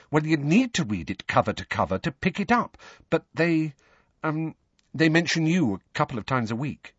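lightly voiced Hermione, pitched high; hurrying on. A narrator's reading. Hermione tells Harry the paper mentions him, hurrying on. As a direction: press on uneasily, with small hesitations. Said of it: "Hermione hurried on".

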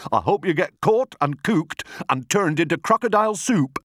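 high-pitched scolding; indignant cry. A narrator's reading. Humpy Rumpy curses the crocodile, high indignant cry. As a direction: curse him in a high, outraged voice. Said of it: "cried Humpy Rumpy".

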